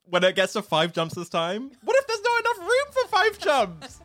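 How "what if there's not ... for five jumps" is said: high-pitched, laughing mock alarm; mock panic. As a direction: squeal it like a comically panicked dancer. Mock panic over dance instructions.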